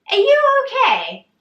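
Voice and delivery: high, loud, incredulous squeal. Retold or imitated. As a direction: re-enact stunned disbelief at the trainer. Retells alarm at heavy weights.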